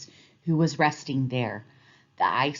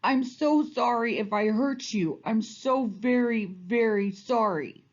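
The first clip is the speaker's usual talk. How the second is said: sobbing; 5 semitones higher than usual. A narrator's reading. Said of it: "he sobbed".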